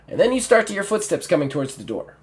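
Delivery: ordinary delivery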